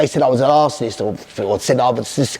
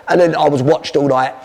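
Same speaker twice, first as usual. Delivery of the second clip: ordinary talk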